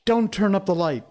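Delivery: alarmed plea, pitched well up; frightened cry. A narrator's reading. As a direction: cry out in fear to stop her. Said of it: "he cried".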